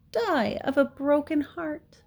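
high, mocking mimicry; Wilbur. A narrator's reading. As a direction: parrot a piglet's plea with scorn. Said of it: "he mimicked".

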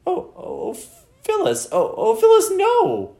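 high, wailing plea; anguished cry shooting way up; squeaky, despairing. A narrator's reading. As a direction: wail it in a loud squeak of despair. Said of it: "in a squeaky, despairing voice".

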